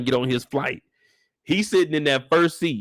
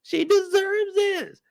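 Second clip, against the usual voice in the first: much higher, slow swooning voice; mock gushing girls; much slower than usual. Girls filming the proposal, high slow swooning mock. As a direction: coo like starstruck fans.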